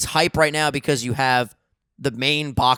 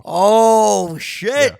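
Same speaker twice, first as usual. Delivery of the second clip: surprised exclaim; 8 semitones higher, 10 dB louder than usual. Real, not acted. Reacting to shocking news.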